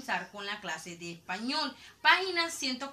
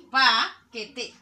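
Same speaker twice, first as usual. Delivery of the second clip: paquete with a loud, long PA, then que-te; stress on PA; 8 dB louder than usual. Shown as a demonstration. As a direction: punch the first syllable of paquete. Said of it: "debe tener la mayor fuerza de voz".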